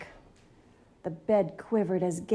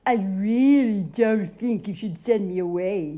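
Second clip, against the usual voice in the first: louder, mock-ominous caution; monster warning; 6 dB louder than usual. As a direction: warn the kid in a goofy monster voice. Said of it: "he warned".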